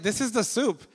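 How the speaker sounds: husband proudly names his dish; proud claim. Retold or imitated. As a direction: present it with pride. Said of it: "I was like".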